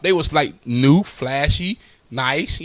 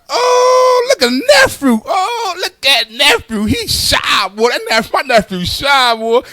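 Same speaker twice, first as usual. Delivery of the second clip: gushing uncle; 9 semitones higher, slower than usual. Retold or imitated. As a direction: coo over the nephew like a proud uncle. Uncle gushing over nephew.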